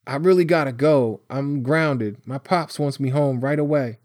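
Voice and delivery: an anxious youngster making his excuse; Tyree, uneasy. Acted. Grounded boy needing to get home, anxious. Frightened boy pleading to leave.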